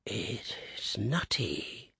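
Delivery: very soft, slow savouring; old man murmuring over the whiskey; faintly. A narrator's reading. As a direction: barely breathe it out, tasting. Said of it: "said faintly".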